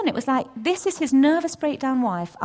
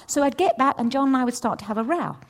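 ordinary delivery

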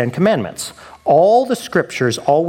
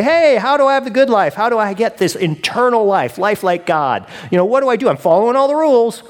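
higher-pitched, eager questioner; pleading eagerly in a raised voice; eager young man; 6 semitones higher than usual. Acted. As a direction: play a keen young man asking for advice. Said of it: "that eager young man".

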